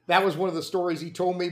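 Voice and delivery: ordinary delivery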